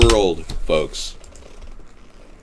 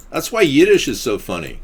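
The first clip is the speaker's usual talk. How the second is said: ordinary talk, nothing marked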